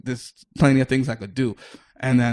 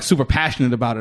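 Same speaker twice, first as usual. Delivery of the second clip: normal talking voice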